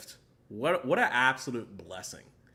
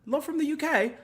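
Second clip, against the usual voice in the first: put-on British accent pitched much higher; a playful British accent; 13 semitones higher than usual. Greeting his UK fans in a mock British accent. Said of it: "when I be hitting these accents".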